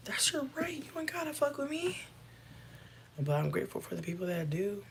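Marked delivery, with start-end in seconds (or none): 0.1-2.0 soft whispered aside. Real, not acted. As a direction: breathe it, no voice. Whispered repeat after plain take.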